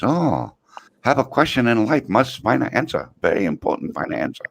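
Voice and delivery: put-on high, broken-English old Chinese man voice; old Chinese man imitation. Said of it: "the little Chinese guy says".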